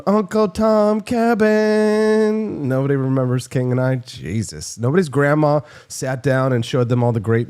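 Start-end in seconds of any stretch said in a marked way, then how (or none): 0.0-2.7 drawn-out mock title. Mangled musical title, drawn out.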